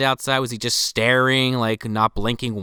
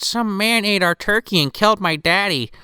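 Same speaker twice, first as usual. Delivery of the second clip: put-on higher little girl voice; 7 semitones higher than usual. Little girl telling the cop, high child voice. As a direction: play the small girl reporting to police. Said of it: "She said".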